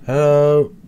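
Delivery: loud call into an empty room; loud searching hello. Real, not acted. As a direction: call out to find who's there. Calling out in the house.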